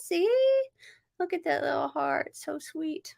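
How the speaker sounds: tender cooing. Real, not acted. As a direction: coo over something cute. Cooing at a card.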